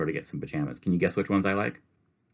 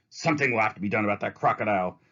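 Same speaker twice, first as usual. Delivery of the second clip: warning words; 4 semitones higher, faster than usual. Acted. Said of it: "warning words of Mister Grumps".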